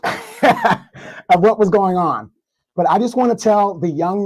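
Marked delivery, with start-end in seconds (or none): 0.0-2.3 slow words through a real laugh. Real, not acted. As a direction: chuckle through the line. Laughs at complainers.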